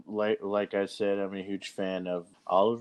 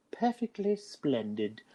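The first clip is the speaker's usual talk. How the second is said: slow, high posh cartoon pig; Peppa Pig voice; 6 semitones higher, slower than usual. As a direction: mimic a prim cartoon pig. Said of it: "Peppa Pig saying".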